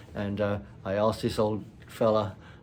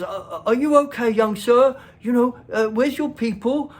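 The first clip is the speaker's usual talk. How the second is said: high, loud, flustered deference; servile old man; 12 semitones higher, 8 dB louder than usual. Retold or imitated. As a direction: fawn like a bowing, scraping old fellow. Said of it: "bowing and scraping and tipping his hat".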